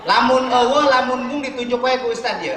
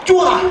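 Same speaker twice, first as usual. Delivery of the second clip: spitting noise; 8 semitones higher, 7 dB louder than usual. Retold or imitated. Imitates father spitting on bite.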